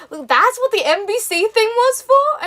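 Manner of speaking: high, loud, drawn-out incredulous question; happy amazement. Retold or imitated. Mum realising what the NBC thing was, happy amazement. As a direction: squeal it as delighted disbelief. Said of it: "she was really happy about it".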